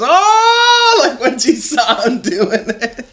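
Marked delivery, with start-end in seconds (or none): 0.0-1.0 very high, loud alarmed "Oh"; mock shocked gasp. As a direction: gasp like a horrified mom. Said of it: "she was".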